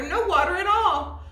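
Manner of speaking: ordinary delivery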